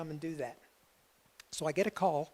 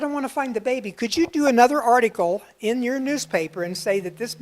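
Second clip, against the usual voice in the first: raised, louder and higher, pressing the request; eager loud plea; 5 semitones higher, 10 dB louder than usual. Retold or imitated. Pleading loudly with the newspaper woman to find the baby, raised and eager. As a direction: push the plea hard and loud. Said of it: "I said".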